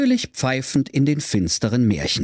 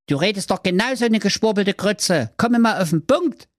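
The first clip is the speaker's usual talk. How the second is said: much higher mocking boy voice; little brother; 10 semitones higher than usual. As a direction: tease her back like a kid. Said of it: "sprach der Brudi zu ihm seiner Schwester".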